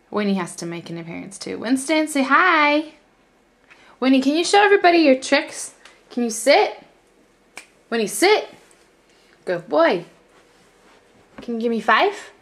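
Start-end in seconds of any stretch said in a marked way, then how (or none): 1.6-12.3 high pet-talk to a dog. Real, not acted. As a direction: coax the dog, high and bright. Coaxing dog to do tricks.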